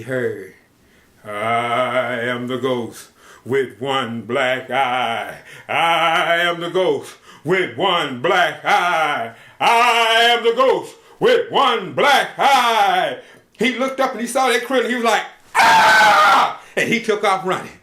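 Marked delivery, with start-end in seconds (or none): 1.2-13.2 ghost voice. Acted. Ghost chants to cowboy. 15.5-16.6 loud scared yell; scream. Acted. Cowboy screams at ghost.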